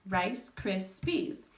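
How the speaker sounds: rice cris peas said almost as one word, with a rise on peas; syllables nearly joined. Shown as a demonstration. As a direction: run rice, cris, peas together quickly. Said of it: "a little bit closer".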